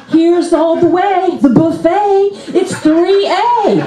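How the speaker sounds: high, slow, inviting call; high mock-hospitable announcement; ushering announcement. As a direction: usher guests to a feast in a drawn-out voice. Ushering zombies to neighbours.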